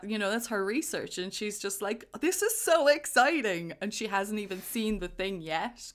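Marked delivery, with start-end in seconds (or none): 2.2-3.7 high, loud, drawn-out gushing; mock excitement. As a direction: gush it slowly, imitating an excited scientist. Voices enthralled scientist's excitement.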